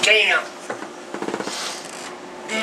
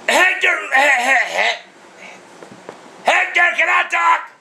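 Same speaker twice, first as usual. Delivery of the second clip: dad shouting to get a word in with the agent, angry yell; shouting over him; 4 semitones higher than usual. Real, not acted. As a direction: cut in and demand the floor. Dad yelling over Comcast agent.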